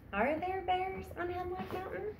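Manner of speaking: put-on high, soft voice of a wondering young boy. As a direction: ask it like a small boy half afraid of bears. Book blurb voicing the boy.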